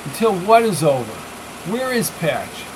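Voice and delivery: Sherry raising her voice at the captor. Acted. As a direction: push the question out sharply. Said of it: "She raised her voice".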